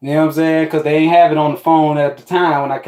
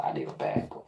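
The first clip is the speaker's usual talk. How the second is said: whispered, voiceless and much softer; under her breath; 14 dB quieter, faster than usual. Retold or imitated. Woman dismisses him, whispered under her breath. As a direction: breathe it out low as a put-down.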